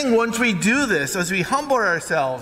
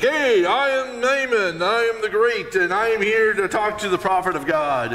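pompous announcing; 6 semitones higher than usual. Retold or imitated. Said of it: "And Naaman's like".

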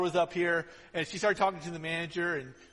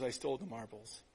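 quiet confession; 5 semitones lower, 8 dB quieter than usual. Retold or imitated. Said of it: "My daughter was talking real quiet".

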